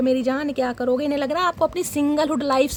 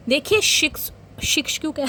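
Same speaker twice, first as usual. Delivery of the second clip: amused at own slip. Real, not acted. Laughs at own slip over "six.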